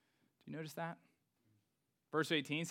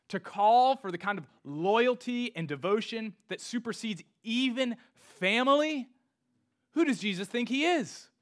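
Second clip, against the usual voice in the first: raised, high-pitched scandalized challenge; incredulous outrage; 8 semitones higher, 8 dB louder than usual. Acted. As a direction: build to an astonished, loud question. Voices outrage at Jesus' bold claim.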